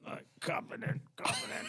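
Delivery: voiceless strained mumble on a held hit; holding breath, imitated. Nervous teen following smoking orders, mimed held-breath voice. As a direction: talk without letting the air out.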